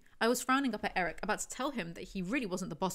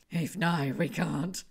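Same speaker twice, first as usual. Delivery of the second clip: rough, low, a bit louder; 3 semitones lower, 4 dB louder, much slower than usual. A narrator's reading. Zoe holding back from Heath, rough and tight. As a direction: push it through rough desire. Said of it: "My voice was rough".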